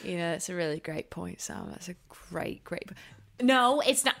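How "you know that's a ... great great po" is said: hushed, almost voiceless aside; whispered aside. Real, not acted. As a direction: whisper it to yourself. Muttered aside before retracting.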